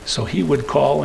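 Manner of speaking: normal talking voice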